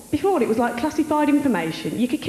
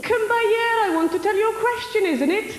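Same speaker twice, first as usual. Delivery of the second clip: higher-pitched, put-on Welsh accent; 9 semitones higher than usual. Comic quoting Welsh phrases to the audience, in a Welsh accent. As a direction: do a sing-song Welsh accent. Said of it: "favourite accent's gotta be the Welsh one".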